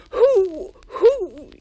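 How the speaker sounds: high, loud terrified stutter; frightened stammer. A narrator's reading. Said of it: "he stammered".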